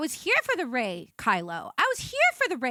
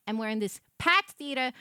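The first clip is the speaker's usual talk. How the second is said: ordinary talk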